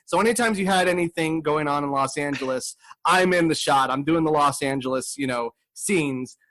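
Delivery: ordinary talk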